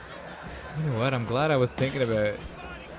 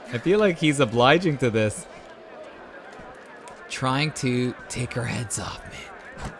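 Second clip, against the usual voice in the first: usual speaking voice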